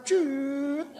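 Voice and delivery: high, drawn-out tyre squeal; imitated brake screech. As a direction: mimic a car's brakes squealing. Imitates car braking at church.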